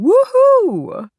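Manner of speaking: high, loud whoop; jubilant shout. A narrator's reading. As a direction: shout it out in celebration. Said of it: "stop herself from shouting".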